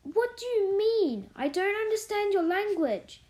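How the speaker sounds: put-on high, affronted child's question; puzzled demand. A narrator's reading. Mary not understanding Martha's language, puzzled demand. Mary baffled by maid's dialect.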